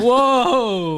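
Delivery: high, loud drawn-out whoa; excited. Real, not acted. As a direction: react with loud amazement. Reacting to drawn card.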